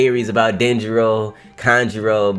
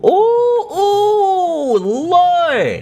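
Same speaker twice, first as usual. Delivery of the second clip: very high, drawn-out wince; keen upward in vicarious pain; wailing shock; much slower than usual. Real, not acted. Viewer watching Odin suffer in boiling oil, high wail. Pained reaction to Odin's suffering.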